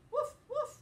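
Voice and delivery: high, loud woof woof; excited dog bark. Acted. Teacher barking as Biscuit for the class, loud and eager. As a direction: bark eagerly like a puppy at play.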